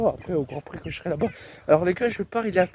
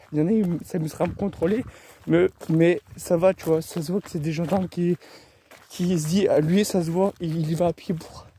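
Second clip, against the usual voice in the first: ordinary talk, nothing marked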